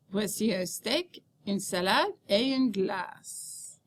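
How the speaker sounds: unhurried list, "steak" and "salade" lifted, "glace" dropped to finish; rising group intonation. Shown as a demonstration. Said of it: "your voice rises after each group of words".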